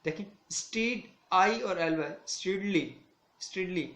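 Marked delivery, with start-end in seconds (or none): 0.5-2.1 stead, then आई, then एल वाई, each set apart; broken into parts. Shown as a demonstration. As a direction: spell out the three parts after stead. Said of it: "three syllable word कहा जा रहा है".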